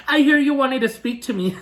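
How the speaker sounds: high, mocking voice with fake laughs; condescending sarcasm. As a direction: imitate a sneering, sarcastic server. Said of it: "very condescending and sarcastic tone".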